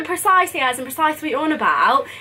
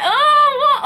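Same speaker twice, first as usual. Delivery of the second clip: high, loud laughing groan of despair; frustrated laughing whine; 12 semitones higher, 5 dB louder than usual. Retold or imitated. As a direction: laugh at her own blunder. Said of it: "I was so frustrated".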